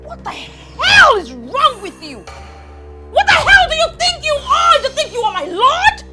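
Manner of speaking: high, loud, indignant shouting; furious tirade. Acted. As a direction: hurl the questions at her in outrage. Angry quarrel with mother-in-law.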